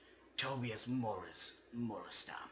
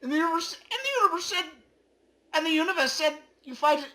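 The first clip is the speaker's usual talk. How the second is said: high, loud, squeaky proclamation; squeaky universe voice; 17 dB louder, much faster than usual. Acted. Voicing the universe to the player as a loud chipmunk.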